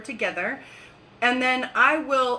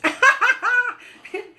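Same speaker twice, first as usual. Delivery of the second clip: laugh; 12 semitones higher, 7 dB louder than usual. Real, not acted. Laughs at her own loopy remark.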